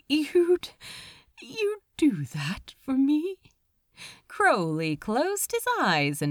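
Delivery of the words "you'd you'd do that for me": touched surprise. Acted.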